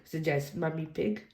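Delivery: usual speaking voice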